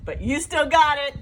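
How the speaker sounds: loud, jokey comeback line; shouted punchline. Acted. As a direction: belt the repeat like a punchline.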